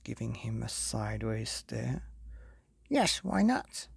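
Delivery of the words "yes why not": high, cheeky little wolf copying. Acted. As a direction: pipe up like a copycat pup.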